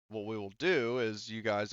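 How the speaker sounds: ordinary talk, nothing marked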